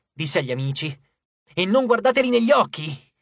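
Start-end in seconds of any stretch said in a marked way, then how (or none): 1.5-3.1 raised, higher urgent call to his friends; urgent warning. A narrator's reading. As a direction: warn them sharply, loud and high. Warning friends facing monsters. (avvertimento urgente ad alta voce)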